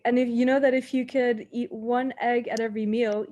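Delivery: usual speaking voice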